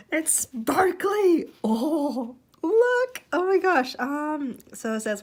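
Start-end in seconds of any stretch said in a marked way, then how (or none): 0.1-3.9 high, drawn-out delighted squeal; thrilled. Real, not acted. Vlogger showing viewers a sparkly card, thrilled. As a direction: gush in high-pitched amazement.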